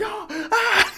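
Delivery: very high laughing yelp of pain; hammed-up yelp, half laughing; mock pain cry. Doctor not being gentle.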